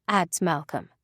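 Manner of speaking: ordinary delivery, nothing marked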